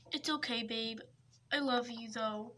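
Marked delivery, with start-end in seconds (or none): none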